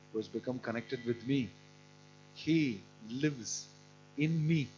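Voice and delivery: usual speaking voice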